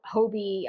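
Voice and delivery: Hobbii pronounced HOH-bee; chosen long O pronunciation. Shown as a demonstration. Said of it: "I'm just gonna go with".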